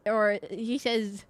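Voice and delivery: usual speaking voice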